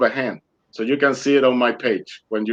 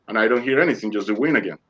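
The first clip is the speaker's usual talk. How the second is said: ordinary talk, nothing marked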